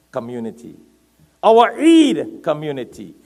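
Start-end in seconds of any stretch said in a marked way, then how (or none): 1.4-2.4 loud, high sermon delivery, words stretched; impassioned preaching. Real, not acted. Preacher urging the congregation not to be aloof, loud and impassioned. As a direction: preach it at full force.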